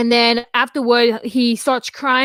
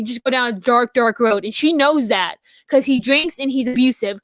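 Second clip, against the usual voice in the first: ordinary delivery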